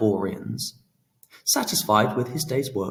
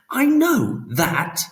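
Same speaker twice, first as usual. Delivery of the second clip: higher, louder, slow impatient retort; 14 semitones higher, 6 dB louder, slower than usual. A narrator's reading. Phoenix retorting to Pan, impatient and louder. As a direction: snap back, offended. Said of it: "Phoenix retorted".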